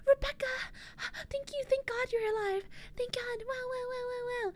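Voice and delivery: very high, gasping, breathless joy; overjoyed relief. Retold or imitated. Alex finds his sister alive, high and overjoyed. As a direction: gush with relief that she's alive. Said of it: "Alex is like".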